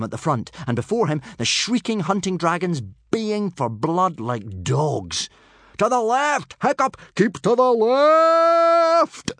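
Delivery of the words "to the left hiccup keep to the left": very high, loud bellowed order; drawn-out furious yell; roar. Acted. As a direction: roar it after the runaway rider, stretching the last left. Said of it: "roared Gobber the Belch".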